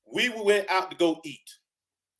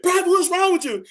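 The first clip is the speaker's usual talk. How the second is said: much higher drill sergeant voice; drill sergeant demand; 12 semitones higher, 4 dB louder than usual. Retold or imitated. Drill sergeant demands answers from the crying private. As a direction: bark the question.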